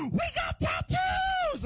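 gasp, then high, stretched-out scream; hammed-up shriek of triumph; ecstatic kid yell. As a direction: imitate a little brother hitting the jackpot. Brother announcing the cereal prize.

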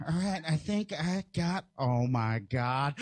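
slow, suspenseful discovery, dropping low; awestruck little brother. Retold or imitated. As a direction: imitate a kid stunned by his find. Brother slowly discovering the prize.

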